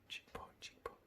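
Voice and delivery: barely audible self-correcting whisper. Real, not acted. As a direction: repeat it under your breath in a whisper. Painter murmuring while working.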